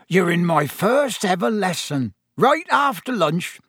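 high, eager proud chatter; bursting with pride; a new teacher excited about his first lesson. A narrator's reading.

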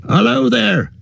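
high calling hail; farmer's cry. A narrator's reading. Maggot hails the unseen rider, high call. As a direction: call out into the fog. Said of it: "cried Farmer Maggot".

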